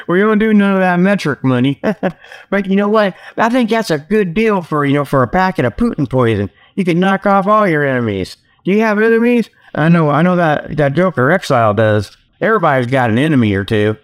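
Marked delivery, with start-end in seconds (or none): none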